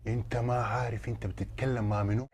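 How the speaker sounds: quiet, low-pitched threat; menacing question, held down; low warning. Acted. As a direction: drop the voice and warn him. Host warns the guest.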